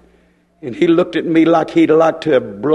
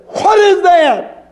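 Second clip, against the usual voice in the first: high, drawn-out cry; retold scream; 12 semitones higher, 3 dB louder, much slower than usual. Preacher retells crying out in the night, screaming. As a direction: scream the question out slowly, much higher. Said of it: "I screamed out and I said".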